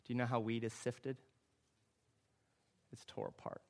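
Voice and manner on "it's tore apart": hushed whisper. Real, not acted. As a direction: whisper it, almost no voice. Preacher drops to breathy hush.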